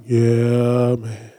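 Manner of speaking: low, slow, droopy donkey voice; mopey cartoon donkey impression; Eeyore voice. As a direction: say happy words in Eeyore's dead gloom. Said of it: "Eeyore, he's always just like".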